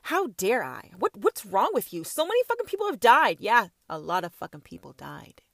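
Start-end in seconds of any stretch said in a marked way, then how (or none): 0.0-3.4 mocking critics. Mocking imagined critics, rising to outrage.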